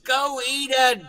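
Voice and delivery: very high, drawn-out mock female wail; imitating a woman singer. Performer imitating the woman at the microphone, singing high. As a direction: belt it out high as the woman's punchline. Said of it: "gave the microphone to a woman".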